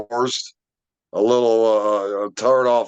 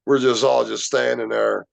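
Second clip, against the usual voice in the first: ordinary delivery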